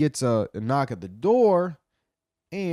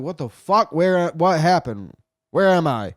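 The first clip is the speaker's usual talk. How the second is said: louder, higher bewildered outburst; alarmed confusion; 4 semitones higher, 6 dB louder than usual. Acted. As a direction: play waking up with no idea where you are. Acted waking up in confusion.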